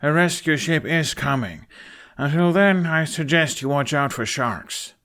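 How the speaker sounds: dry and flat. A narrator's reading. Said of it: "a flat look".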